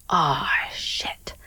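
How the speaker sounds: soft unvoiced swear; whispered curse. Wife realizing her husband wet the bed, whispered curse. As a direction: let it slip out as a breathless whisper. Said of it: "So I'm like".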